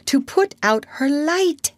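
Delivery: high-pitched plea; beg, with the voice lifted high; begging. A narrator's reading. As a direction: plead as an anxious girl would. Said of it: "Wendy begged".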